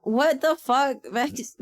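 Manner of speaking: ordinary talk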